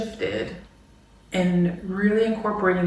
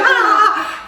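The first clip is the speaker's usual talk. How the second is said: high, loud laughing shriek; 14 semitones higher, 7 dB louder than usual. Retold or imitated. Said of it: "we're, like, all laughing".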